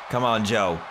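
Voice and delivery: usual speaking voice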